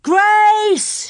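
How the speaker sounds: high, loud building scream; girl searching alone. A narrator's reading. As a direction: scream her name across the grass. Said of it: "she screamed".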